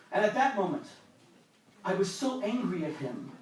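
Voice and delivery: ordinary talk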